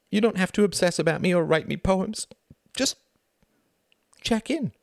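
high, strained appeal; pleading. Acted. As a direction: beg for one small thing. Wife pleading in a quarrel.

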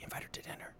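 hushed, whispered aside; whispered prompt. Retold or imitated. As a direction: whisper it like a discreet prompt to someone. Lumiere quietly prompts the Beast.

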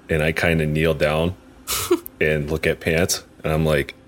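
normal talking voice

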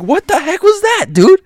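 very high, loud shriek of alarm; acted high-pitched panicked yell; retold freaked-out outburst. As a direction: shriek it in disbelief. Retold freaked-out reaction, repeated.

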